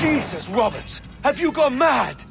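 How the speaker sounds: high, loud cry of disbelief; shocked outburst. Acted. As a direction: recoil from what you just saw. Edward sees the ancient door open.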